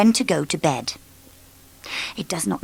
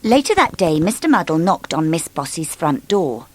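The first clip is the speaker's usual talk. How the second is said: plain reading voice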